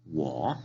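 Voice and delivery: "Wo" dipping low before it rises; third tone. Shown as a demonstration. Teacher asking learner to repeat, low-rising tone. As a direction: scoop "Wo" from the bottom up. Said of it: "Es un tono de abajo hacia arriba".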